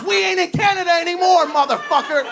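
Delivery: high shouted threat; mock yell. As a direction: roar it in his face.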